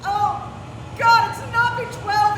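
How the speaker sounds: high, loud horrified cry; panicked realization. Acted. Alice realizing George's shot was not B12, panicked. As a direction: gasp out the awful truth.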